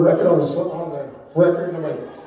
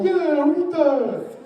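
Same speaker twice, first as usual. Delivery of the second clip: mocking dim voice; 8 semitones higher, much slower than usual. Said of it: "they go".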